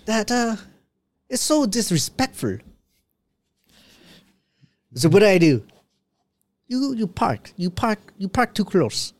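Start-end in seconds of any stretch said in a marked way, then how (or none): 0.0-2.6 high, put-on African-Russian accent; mock offended African-Russian voice. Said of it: "African guy mixed with a little tiny bit of Russian". 6.7-9.1 high, halting African-Russian mock accent; put-on African-Russian neighbour voice. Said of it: "African guy mixed with a little tiny bit of Russian".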